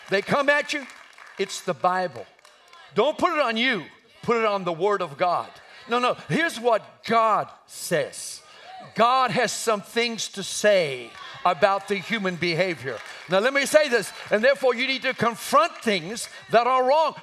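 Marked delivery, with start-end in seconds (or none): none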